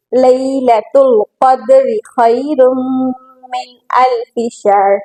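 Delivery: slow, drawn-out tajweed recitation; Quran recitation. Shown as a demonstration. Teacher reciting a verse for students, measured tajweed recitation. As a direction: recite slowly, holding nasals and long vowels.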